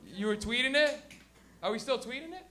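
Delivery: usual speaking voice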